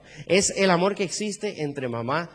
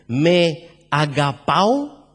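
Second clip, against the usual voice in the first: "agapao" drawn out slowly, rising as a question; stress on agapao; 2 semitones lower, 4 dB louder, much slower than usual. Shown as a demonstration. Preacher reads Jesus' question in Greek, stressing "agapao" slowly. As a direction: stretch "agapao" out so the Greek word stands out. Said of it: "mira cómo se lee".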